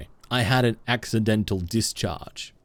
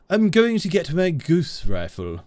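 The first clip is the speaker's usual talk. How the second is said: higher, put-on character voice; silly put-on voice; 5 semitones higher than usual. Streamer joking about a goose rifle, silly put-on voice. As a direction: play it silly and high.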